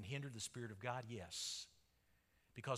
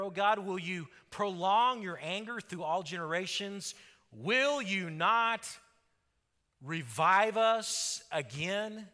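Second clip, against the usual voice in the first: loud, pleading psalm reading, slowing at the end; crying out to God; 4 semitones higher, 8 dB louder than usual. Acted. Pastor reading the psalmist's plea, loud and slowing. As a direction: beg God on behalf of the people. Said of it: "the psalmist is crying out to God".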